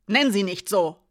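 loud sudden snap; snapped out. Acted. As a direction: flare up without thinking. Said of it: "fuhr Alina unwillkürlich auf". (Alina fährt Hiraya an, plötzlich und laut)

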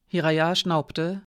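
usual speaking voice

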